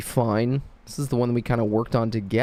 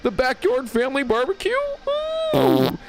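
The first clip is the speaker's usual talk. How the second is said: very high, cooing falsetto toilet voice; high-pitched sentient toilet, drawn-out and singsong; imagined talking toilet; 14 semitones higher than usual. Acted. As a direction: coo it in a silly falsetto, delighted with the visitor. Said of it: "That's how I imagine a toilet would sound".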